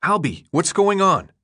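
high, insistent plea for answers; exasperated demand. A narrator's reading. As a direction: call the name, then demand an answer. Thomas couldn't stand it anymore.

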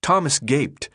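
ordinary delivery, nothing marked

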